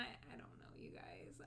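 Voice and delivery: unsure whisper. Real, not acted. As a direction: breathe it out, embarrassed. Shy, unsure about doing voices.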